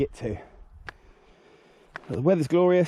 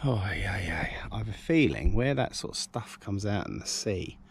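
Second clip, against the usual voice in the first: whispering; 3 semitones lower, 5 dB quieter than usual. Real, not acted. Whispering while walking.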